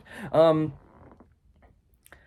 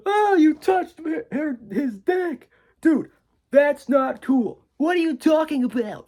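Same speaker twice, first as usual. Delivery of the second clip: much higher, slow, strained mock frat guy; Dave Franco impression; 14 semitones higher, 5 dB louder, slower than usual. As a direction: squeak out a hammed-up outraged bro. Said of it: "that's my impression of Dave Franco".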